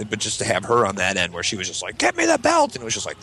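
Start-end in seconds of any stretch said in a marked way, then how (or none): 2.0-2.7 very high mock screech; furious mom. Mom turning on her kid, high imitated screech. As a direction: shriek the order in a mom voice. Retold mom demanding the belt.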